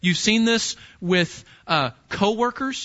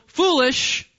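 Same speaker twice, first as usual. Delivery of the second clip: mock dismissal; 8 semitones higher, 4 dB louder than usual. Repeats the world's verdict.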